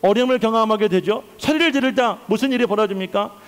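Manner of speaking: usual speaking voice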